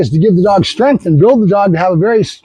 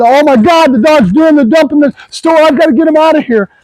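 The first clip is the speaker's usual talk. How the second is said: high, loud flustered owner panicking; mock panic; 7 semitones higher, 4 dB louder than usual. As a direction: play the embarrassed owner, voice shooting up in alarm. Owner panicking over dog's accident.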